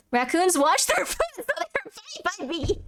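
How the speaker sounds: high, breaking into helpless laughter; laughing. Real, not acted. Streamer reading out a remark about raccoons, cracking up. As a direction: lose it halfway, slowing and fading into squeaks.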